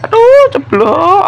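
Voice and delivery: high, slow, loud; dismayed cry when it drops; surprised yelp. Real, not acted. Food dropped.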